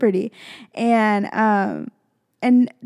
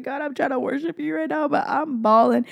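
retold tearful prayer; 5 semitones higher than usual. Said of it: "I was crying, y'all".

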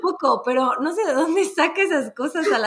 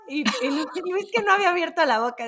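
ordinary talk

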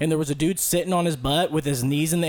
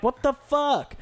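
much higher, startled outburst; startled what the fuck; 10 semitones higher, slower than usual. Retold or imitated. Concertgoer hit by a crowd surfer, high startled outburst. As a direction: react like you just got kicked in the head. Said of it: "You're like".

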